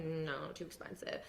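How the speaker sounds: low, soft flat no, too expensive; dismissive skeptic. Retold or imitated. Friends refusing Bitcoin at twenty thousand, low and dismissive. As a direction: brush it off, a bit muttered. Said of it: "They're like".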